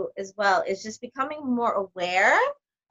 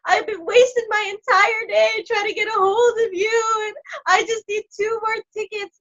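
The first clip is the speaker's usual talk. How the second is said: much higher, loud, tearful complaint; imitating a crying caller; 10 semitones higher, 5 dB louder than usual. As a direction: whine it through mock sobs. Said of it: "she starts crying".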